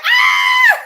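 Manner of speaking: high delighted scream. Retold or imitated. Viewer spotting a friend's comment, high scream. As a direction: let out a squealing shriek. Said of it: "I screamed".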